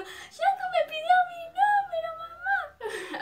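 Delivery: very high tearful wail; hammed-up squeaky sobbing with joy; mock happy crying. Dreamer tells mom about [name], mock tearful squeal. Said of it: "empiezo a llorar".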